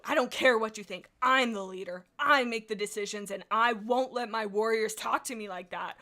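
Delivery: defiant leader. Acted. Streamer voicing the clan leader's defiance, loud and commanding. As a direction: bark it like a proud clan leader. Re-reading leader's outburst dramatically.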